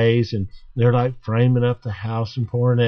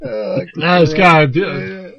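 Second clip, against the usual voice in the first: ordinary talk, nothing marked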